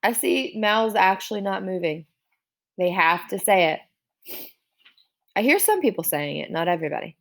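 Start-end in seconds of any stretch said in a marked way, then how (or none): none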